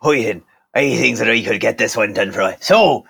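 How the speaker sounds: pirate drifting into Irish priest; pirate voice that slips Irish. Acted. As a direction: start pirate, slide into Irish. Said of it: "you've gone quite, uh, Father Ted there".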